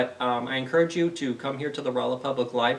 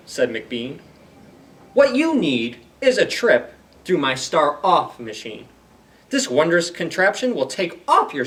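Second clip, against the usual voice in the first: animated storybook voice with bouncy rhyme; reading to children; 3 semitones higher, 6 dB louder than usual. Acted. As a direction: perform the rhymes for a young audience. Reads Seuss story aloud.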